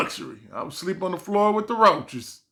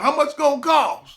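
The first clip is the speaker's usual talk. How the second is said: squeaky nervous question; mock anxious; 10 semitones higher, 3 dB louder than usual. Asking the mechanic about the cost, anxious and high. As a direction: voice jumps way up, worried.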